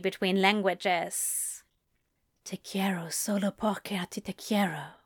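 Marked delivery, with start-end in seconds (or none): 2.4-5.0 breathy whisper back. A narrator's reading. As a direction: whisper it close. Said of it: "Crowley whispered back".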